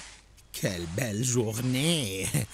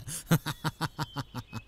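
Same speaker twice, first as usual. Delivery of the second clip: mocking laugh; 4 semitones higher, 5 dB quieter than usual. Townsman laughing at Lot.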